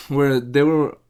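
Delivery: ordinary delivery, nothing marked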